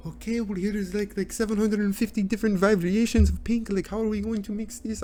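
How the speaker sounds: put-on high-pitched, uncertain Italian accent; high voice in a wobbly Italian accent; muddled attempt at an Italian accent. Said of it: "I don't know what accent I'm doing".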